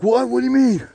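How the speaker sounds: high, startled questioning; imitated bewildered protest. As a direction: act out a man caught off guard. Woken man protests, confused.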